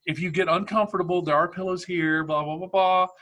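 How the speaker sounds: lightly voiced overly polite flight attendant; mock-sweet helpful stewardess tone. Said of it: "she turned to me and she's like".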